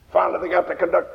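ordinary talk

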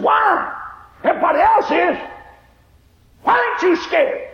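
panicked questioning. Retold or imitated. Old woman begging the calm girl why. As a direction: demand it in panic. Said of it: "She got frantic and hysterical".

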